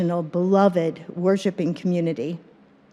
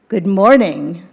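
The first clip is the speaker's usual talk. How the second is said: ordinary talk, nothing marked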